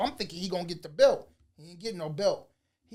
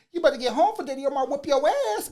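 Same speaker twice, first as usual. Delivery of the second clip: loud, high, fast imitation of a taunting girl; mocking call-out; 7 semitones higher, 7 dB louder, faster than usual. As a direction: holler it teasingly, as from a car window. Girl shouts from car window, mocking.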